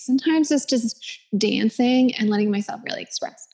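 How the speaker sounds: ordinary talk, nothing marked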